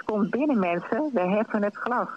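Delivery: ordinary delivery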